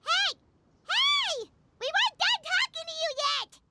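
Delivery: high calling shout after them. Acted. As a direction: call after them as they leave. Calling after departing group.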